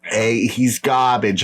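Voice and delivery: slow mock Boston, garbage said as kahbidge; light Boston accent, slow. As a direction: play a Boston tough guy, lightly. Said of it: "That's my Boston accent".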